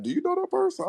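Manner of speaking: very high-pitched imitated question; mock incredulous caller. Imitating someone who phoned to ask, high mock incredulity. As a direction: squeak out a caller's nosy question.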